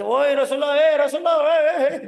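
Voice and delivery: high, very loud hollering; rude Bedouin shouting. Acted. Bedouin hailing the Prophet without manners, loud high shouting. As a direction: yell out like an unmannered villager. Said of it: "belum tahu bagaimana cara bicara".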